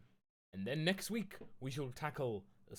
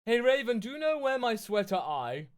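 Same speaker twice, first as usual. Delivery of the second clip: put-on higher, louder valley-girl author read; mock author voice; 9 semitones higher, 9 dB louder than usual. Streamer reading the author's note to viewers, mock teen-girl voice. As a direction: ham up the teen author gushing.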